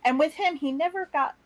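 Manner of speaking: ordinary delivery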